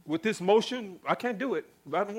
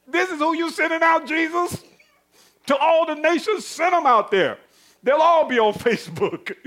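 high, loud jeering with a laugh; taunting devil; 6 semitones higher, 5 dB louder than usual. Acted. Devil mocking Jesus over who he sends out, taunting. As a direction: jeer in loud, raised bursts, laughing near the end.

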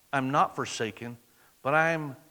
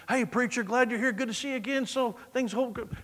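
fake cheer; 6 semitones higher, 5 dB quieter than usual. Churchgoer faking cheerful greeting.

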